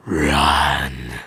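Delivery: deep, menacing monster growl; scary voice. Acted. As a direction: drop low and threaten. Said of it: "do a scary voice".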